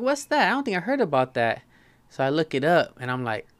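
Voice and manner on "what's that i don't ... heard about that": confused surprise. Retold or imitated. Acting his reply to cousin.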